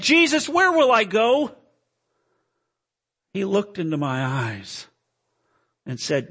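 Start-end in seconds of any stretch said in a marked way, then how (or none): 0.0-1.6 high, loud, anguished cry; desperate question. Acted. Acted sinner realizes his fate.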